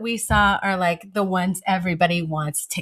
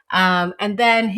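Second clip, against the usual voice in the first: ordinary delivery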